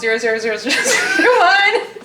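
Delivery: robot counting in binary, laughing; toy robot voice. Pretend. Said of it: "in binary".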